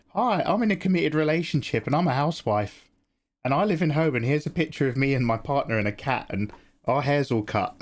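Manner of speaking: high, teasing housewife impersonation; teasing imitation of a settled partner; mock housewife. Mock-voices listener as settled housewife.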